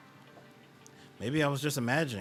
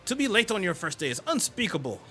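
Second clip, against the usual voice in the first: stern scolding; 5 semitones higher than usual. Acted. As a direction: scold him like a rule-obsessed prefect. Stern hall monitor scolding.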